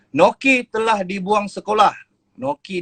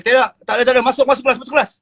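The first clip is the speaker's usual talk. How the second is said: teacher ordering; 7 semitones higher, much faster than usual. Retold or imitated. Teacher ordering student back to class.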